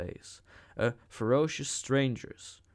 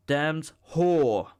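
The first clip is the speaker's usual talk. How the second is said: angry shout; 4 semitones higher, 6 dB louder than usual. A narrator's reading. Savage keeps cursing her.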